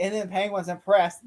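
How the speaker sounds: ordinary talk, nothing marked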